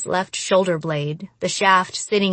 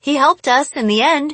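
quick, raised protest; arguing; 6 semitones higher, 4 dB louder, much faster than usual. Acted. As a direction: push back, insisting. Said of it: "I argued".

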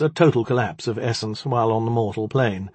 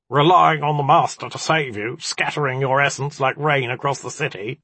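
amused, raised-voice taunt; chuckling mockery; 5 semitones higher, 3 dB louder than usual. A narrator's reading. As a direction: jeer with a hint of a chuckle.

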